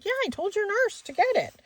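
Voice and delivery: high-pitched, exasperated comeback; exasperated retort. Retold or imitated. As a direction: fire back high, imitating your own frustration. Retold exasperated reply to doctor.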